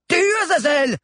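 high, loud screamed order; insistent shout. A narrator's reading. Chado insists to his unmoving caracal, high insistent shout. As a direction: insist at full voice. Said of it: "insista Chado".

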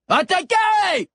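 high, drawn-out shouted command; shouted order. A narrator's reading. A voice orders the beasts to attack, high drawn-out shout. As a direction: yell the attack order at full force. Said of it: "ordonna une voix".

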